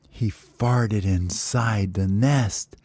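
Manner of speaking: usual speaking voice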